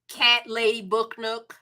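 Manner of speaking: ordinary delivery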